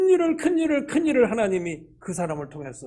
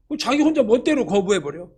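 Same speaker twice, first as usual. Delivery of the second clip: ordinary talk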